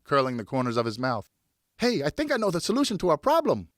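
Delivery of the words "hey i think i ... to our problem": put-on high, eager boyish call; lightly voiced boy shouting; excited shout. A narrator's reading. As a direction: call out the bright idea. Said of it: "he shouted".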